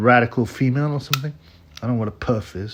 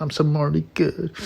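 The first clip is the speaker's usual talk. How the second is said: mock self-righteousness; 6 semitones higher, 4 dB quieter than usual. Mocking virtue signallers' self-praise.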